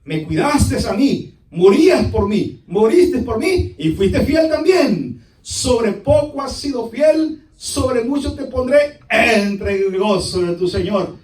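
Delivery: slow, emphatic preaching voice; proclaimed praise. Acted. The Lord praising a loyal servant, slow proclamation. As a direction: proclaim it slowly, stretching the key words.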